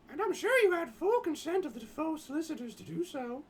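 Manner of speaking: high, haughty reporter voice, lightly done; Simone voice. Acted. Reading the reporter Simone's retort to the intruder, high put-on voice.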